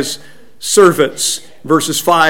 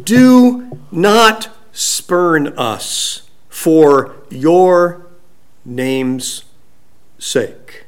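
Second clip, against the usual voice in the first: very slow, weighted word by word; measured recital, falling away at the end; solemn plea; much slower than usual. Retold or imitated. Preacher reciting Jeremiah's plea to God, solemn and very slow.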